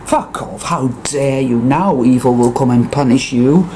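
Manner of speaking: ordinary talk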